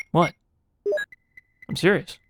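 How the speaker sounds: ordinary delivery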